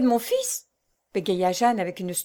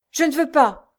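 louder, higher resistance; struggling refusal; 6 semitones higher, 6 dB louder than usual. A narrator's reading. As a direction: fight them off with the words. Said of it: "en se débattant". (refus en se débattant)

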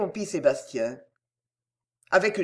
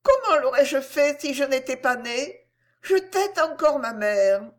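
innocent; 6 semitones higher than usual. A narrator's reading. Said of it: "répondit avec innocence".

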